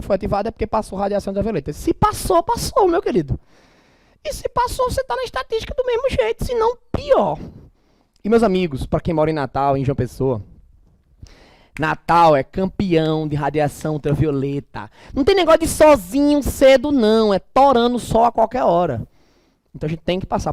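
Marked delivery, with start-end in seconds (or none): 1.7-7.4 high mocking falsetto; squeaky put-on voice mocking the listener. 15.1-19.1 emphatic. Real, not acted. Emphatic warning about the sun.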